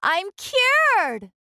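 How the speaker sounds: very high gleeful cry; joyful shout. Acted. Camilla celebrating her cure, high and joyful. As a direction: burst out in delight. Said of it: "she shouted".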